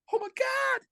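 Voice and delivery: very high, strained cry of relief; retold exhausted outcry. Finishing punishment, retold exhausted outcry.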